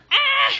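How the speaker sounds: sharp high loud scream of alarm; startled scream. Real, not acted. Mom sees her toddler slip, high alarmed scream.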